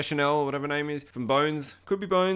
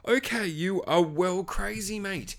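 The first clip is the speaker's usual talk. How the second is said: slow, deliberate, higher-pitched delivery; mock verdict; 5 semitones higher, slower than usual. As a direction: spell it out slowly for a madman. Viewer's verdict on crazy character.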